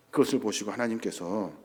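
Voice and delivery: ordinary talk, nothing marked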